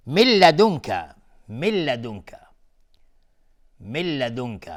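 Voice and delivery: من لدنک with nun merged into lam, no nasal; tajwid demo. Shown as a demonstration. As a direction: model من لدنک with loud, sharp start. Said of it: "ادغام بدون غنه".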